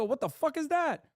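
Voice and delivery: high, baffled imitation of Europeans; incredulous outburst. Europeans gawking at American politics, high baffled outburst. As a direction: play the stunned outsider at a loss. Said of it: "They look at that and go".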